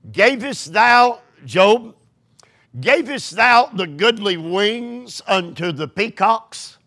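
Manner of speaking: scripture reading. Acted. Reads scripture aloud, raised.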